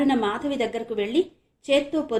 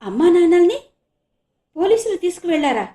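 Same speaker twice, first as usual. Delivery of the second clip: astonished; 8 semitones higher, 11 dB louder than usual. Acted. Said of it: "ఆశ్చర్యపోతూ అన్నాడు".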